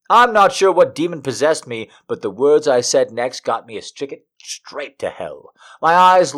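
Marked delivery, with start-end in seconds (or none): none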